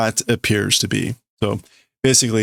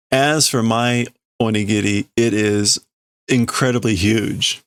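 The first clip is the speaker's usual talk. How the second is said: ordinary talk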